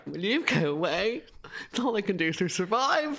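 high, whiny retold protest; mock distraught. Daughter retelling snap at mum over wine, mock distraught. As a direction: plead shrilly for being left alone.

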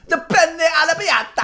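high, slow hammed-up Italian order; mock Italian accent. Ordering at an Italian restaurant, hammed-up Italian accent. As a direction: sing out the pasta in mock Italian, high-pitched. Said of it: "you go to an Italian restaurant".

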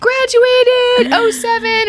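high, drawn-out imitated shout; mock holler. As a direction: holler it slowly to the next car. Retold stranger shouting between cars.

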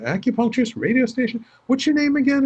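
retold puzzled questions, pitched high; dawning recognition.

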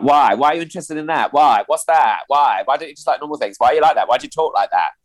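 high, insistent string of whys; imitated pestering questions. People around him questioning his oddness, imitated badgering.

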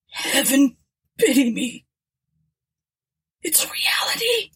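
high, strained cry of terror; a terrified man breaking at the end; terrified groan. A narrator's reading. Jackson groans his terror to the captain, high and strained. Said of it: "he groaned between chattering teeth".

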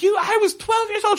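very high, imitated outrage; acted outraged protest. Nora confronting her abuser, acted outrage. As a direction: hurl it at him in a high, acted voice.

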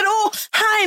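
high mock chirpy hi; imitating a sweet greeting. Host retells fan greeting her, high mock chirpy voice. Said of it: "The way she just said".

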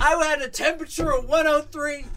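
comic indignant shouting; mock outraged rant. Real, not acted. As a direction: rant at the friends through laughter. Outraged mock rant about friends.

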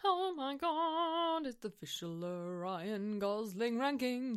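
slow, awed echo of the title; mock announcer. Co-host echoing the segment title, in a slow mock-grand announcer voice. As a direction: drag it out like a big reveal.

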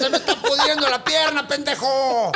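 put-on high, scolding old woman's voice; shrill doña Florinda. Wounded witch insulting the neighbors, high and scolding. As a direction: snap at them like doña Florinda. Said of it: "doña Florinda".

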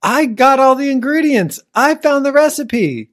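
hammed-up fury over a pie; mock outrage.

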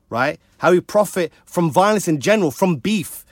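ordinary delivery

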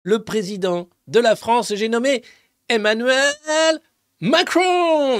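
mock announcer. Announcing the president's grand entrance, building to a big call. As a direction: hype up the entrance.

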